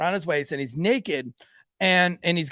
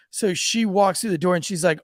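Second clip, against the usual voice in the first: normal talking voice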